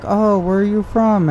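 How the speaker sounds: high, sugary imitation of a scammer; mock scammer voice. Mimicking the online scammer asking where he's from, high and sugary. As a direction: pitch it up and play innocent. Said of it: "And they're like".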